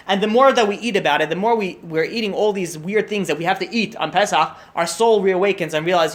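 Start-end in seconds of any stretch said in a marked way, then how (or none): none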